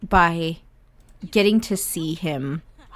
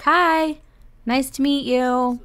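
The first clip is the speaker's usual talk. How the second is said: little brother voice; 6 semitones higher than usual. Acted. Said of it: "He's like".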